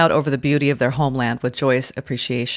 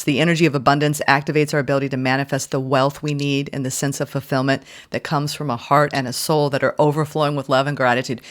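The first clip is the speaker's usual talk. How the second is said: normal talking voice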